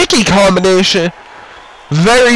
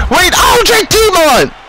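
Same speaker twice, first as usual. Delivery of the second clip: surprised shout; 10 semitones higher than usual. Acted. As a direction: yell the surprise entrance. Surprise return of wrestler.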